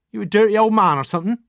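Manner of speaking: put-on Oxfordshire accent. A narrator's reading. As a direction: fling the insult in broad Oxfordshire. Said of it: "her curly Oxfordshire accent".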